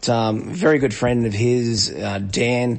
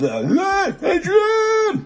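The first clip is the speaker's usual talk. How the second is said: high shouted Adrian, Rocky style; Rocky impression; much slower than usual. Host imitates Rocky calling for Adrian, shouted high. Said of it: "chatting a bit of Stallone".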